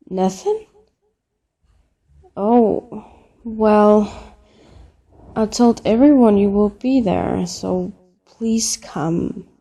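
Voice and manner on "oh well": ordinary talk, nothing marked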